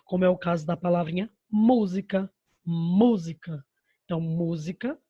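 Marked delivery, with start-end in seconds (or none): 1.5-2.3 "música" with a strong first syllable "mú"; teacher's example "música", stressed third from the end. Shown as a demonstration. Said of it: "antepenúltima sílaba é a mais forte". 2.6-3.6 "música" said slowly with a strong, stretched "mú"; slow teacher's demo of "música", stressed on the first syllable; drawn-out stress on MÚ. Shown as a demonstration. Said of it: "antepenúltima sílaba é a mais forte".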